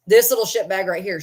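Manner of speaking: ordinary delivery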